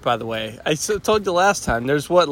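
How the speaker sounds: ordinary talk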